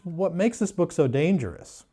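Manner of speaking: ordinary talk, nothing marked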